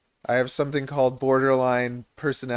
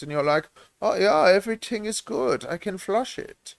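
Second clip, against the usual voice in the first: put-on higher-pitched comic German accent; 4 semitones higher than usual. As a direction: explain it as a hammed-up German. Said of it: "my German accent".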